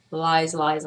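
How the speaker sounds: ordinary talk, nothing marked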